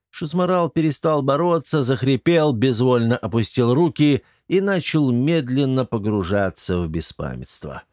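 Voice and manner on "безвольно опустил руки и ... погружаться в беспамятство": ordinary delivery, nothing marked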